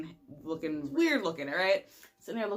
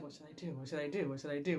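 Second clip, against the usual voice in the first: much softer, anxious repeated muttering; panicked inner thoughts; 4 semitones lower, 9 dB quieter, much faster than usual. Retold or imitated. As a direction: mumble it to yourself in fear. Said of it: "Just thinking, like".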